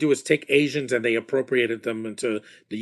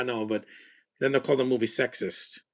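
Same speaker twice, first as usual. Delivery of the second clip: usual speaking voice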